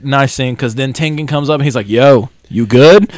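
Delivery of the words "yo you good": slow, loud casual check-in; casual hail. Retold or imitated. Tengen comes up to check on the hero, slow loud hail. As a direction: hail a buddy, loud and drawn out.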